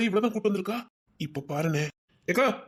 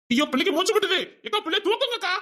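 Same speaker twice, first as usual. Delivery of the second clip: high, urgent wail of concern; acted alarm; 9 semitones higher than usual. Urging sister to pick up the baby, alarmed. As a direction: cry out in showy panic, pitched high.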